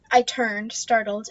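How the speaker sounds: usual speaking voice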